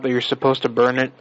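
ordinary talk

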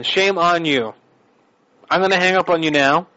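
usual speaking voice

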